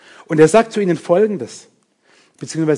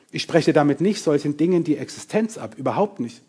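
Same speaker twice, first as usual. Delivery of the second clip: normal talking voice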